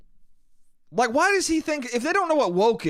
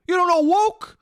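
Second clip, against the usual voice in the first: high, loud, incredulous yell of the uncle; mock shouting uncle; 12 semitones higher, 4 dB louder, slower than usual. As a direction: bark it at him like he's clueless. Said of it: "And he goes".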